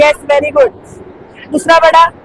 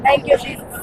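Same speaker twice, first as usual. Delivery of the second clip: whispered thanks; 3 semitones lower, 9 dB quieter, much slower than usual. Real, not acted. Quiet thanks during healing prayer.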